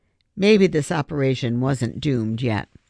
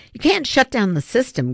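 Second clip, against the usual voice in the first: warning; 8 semitones higher, 4 dB louder than usual. A narrator's reading. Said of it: "Griffin warned".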